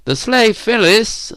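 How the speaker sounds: slow, high-pitched, emphatic announcement; rather loud. A narrator's reading. Narrator needles Phyllis within her hearing, rather loud. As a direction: announce it pointedly, drawn out. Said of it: "I said rather loudly again".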